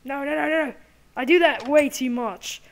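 loud, high cry of dismay; high-pitched exasperated shout; alarmed shouting. Real, not acted. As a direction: yell it out in alarm. Alarm during game fight.

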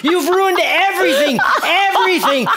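screaming at her for ruining it; furious outburst. Acted. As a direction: lash out in rage. Outburst at failed rehearsal.

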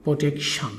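প্রোটেকশন whispered, ending in shan; whispered correct form. Shown as a demonstration. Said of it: "উচ্চারণ হবে".

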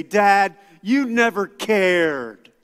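put-on loud, slow accusing teen; mock teenager accusing dad; teen accusation. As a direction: throw the blame at dad.

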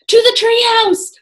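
high loud cry. A narrator's reading. Jack crying out to flee the Vikings. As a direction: shout the escape plan. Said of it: "cried Jack".

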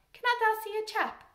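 lifted, attention-seeking call; robin's plea. Acted. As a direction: pipe up as a cheeky robin. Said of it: "he's saying".

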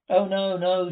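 loud, emphatic protest; crying out. A narrator's reading. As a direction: cry out the refusal with sudden volume. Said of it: "she cried".

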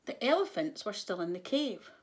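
plain reading voice

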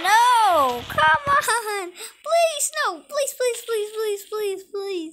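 high, loud desperate pleading; panicked begging. Real, not acted. Gamer begging the enemy monster to spare him, panicked. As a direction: beg for mercy, voice rising high.

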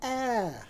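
high, held "Ah" as the short a of cat; Annie's sound. Shown as a demonstration. Teacher demonstrating Annie's sound, high long "Ah" as in adding. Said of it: "What's her sound?".

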